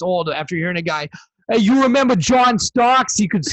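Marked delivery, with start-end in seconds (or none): 1.5-3.4 loud, high Brooklyn guy slipping into Boston; attempted Brooklyn accent. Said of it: "talking like I'm from Boston. I was trying to do a Brooklyn accent".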